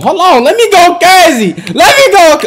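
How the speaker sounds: high, loud excited yell; hype yell. Real, not acted. Streamer hyping himself up mid-game, loud high yell. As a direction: rev yourself up loudly. Hyped before shooting.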